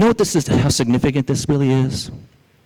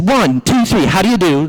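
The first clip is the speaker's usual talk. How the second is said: high-pitched warning countdown; acted-out angry storybook rabbit; furious rabbit; 7 semitones higher, 3 dB louder than usual. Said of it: "He's getting furious".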